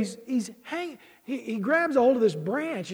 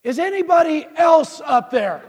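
high, loud holler as a punchline; loud, high-pitched call upward; calling up for help; 6 semitones higher, 9 dB louder than usual. Acted. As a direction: shout past the first answer for someone else. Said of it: "and the guy says".

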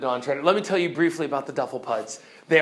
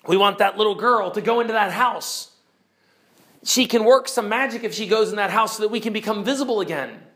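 high, insistent creature voice; emphatic storybook creature demand; demanding invisible creatures; 7 semitones higher, 5 dB louder, faster than usual. Acted. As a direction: make the demand urgently in a raised voice. Invisible creatures make their demand.

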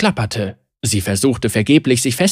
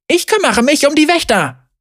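shouted order; 12 semitones higher than usual. A narrator's reading. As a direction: scream it at the hostages. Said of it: "schrie Zeri".

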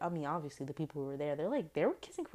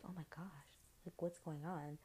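much softer, stunned and hurt; mock hurt shock; 14 dB quieter than usual. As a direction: say it quietly, blindsided. Shocked bachelor, near tears.

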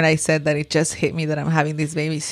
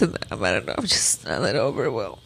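near tears; 8 semitones lower, 5 dB quieter than usual. Real, not acted. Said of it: "I think I'm gonna cry".

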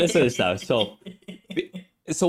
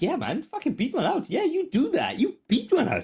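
high, hammed-up egging on; mock encouragement. Streamer hyping up a creepy viewer in mock cheer. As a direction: cheer him on over the top. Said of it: "I was like".

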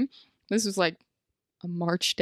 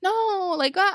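mock flustered mom; 11 semitones higher, slower than usual. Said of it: "she's like".

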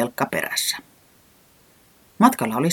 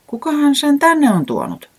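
wondering; 13 semitones higher, 5 dB louder than usual. A narrator's reading. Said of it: "ihmetteli Reporankka".

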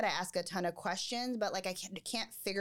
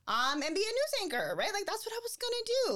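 higher, faster, bubbling over; rising with excitement; excited; 13 semitones higher than usual. Real, not acted. Excited about childhood dream.

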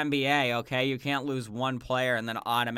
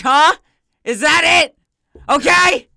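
mock rant; 13 semitones higher, 13 dB louder than usual. Ranting at friend over Yankees.

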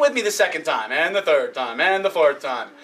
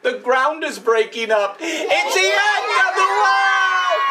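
teacher leading the class in a panicked chant; mock panic; 6 semitones higher than usual. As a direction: cry out like the sky is falling. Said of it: "they said".

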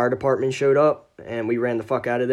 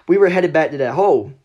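usual speaking voice

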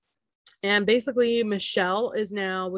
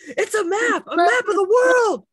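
loud high yell; shouting; 14 semitones higher, 9 dB louder than usual. Retold or imitated. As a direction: yell it out in frustration. Imitating cast member yelling at puzzle.

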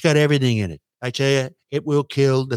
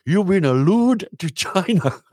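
put-on higher, laughing through the accented line; mock accent, cracking up; mock Chinese accent; 6 semitones higher than usual. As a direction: repeat the accusation in a put-on accent.

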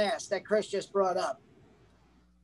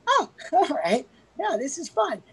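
mock carefree; 5 semitones higher than usual. There's never a moment where you're just like.

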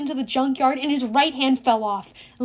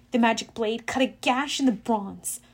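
plain reading voice

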